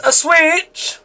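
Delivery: high, stretched exclamation; excited repeat. A narrator's reading. Ashley explaining the prize to David, excited.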